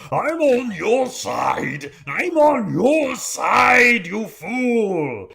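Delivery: high, loud, drawn-out villain's yell; desperate shouting. Acted. Bugbear yells at Zuana that he is an ally, full shouting. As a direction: shout it slowly, stretching every word. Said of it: "is shouting at you".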